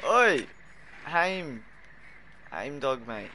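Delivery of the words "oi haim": calling out. Real, not acted. Calling out to teammate.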